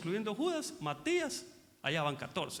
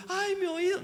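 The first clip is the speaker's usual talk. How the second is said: whining grown man; 11 semitones higher, 4 dB louder than usual. Retold or imitated. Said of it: "no encuentra en la calle uno".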